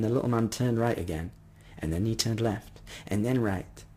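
usual speaking voice

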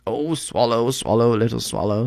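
lightly voiced prince coaxing; pleading prince. A narrator's reading. Prince urges reluctant swallow to obey, pleading.

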